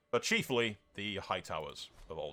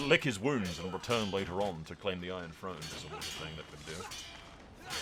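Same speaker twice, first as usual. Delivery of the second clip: ordinary talk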